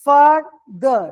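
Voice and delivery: further split into two distinct syllables. Shown as a demonstration. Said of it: "দুটো syllable".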